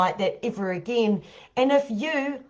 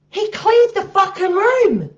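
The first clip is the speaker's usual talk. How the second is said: amazed outburst; 9 semitones higher, 6 dB louder than usual. Real, not acted. Telling the climax punchline.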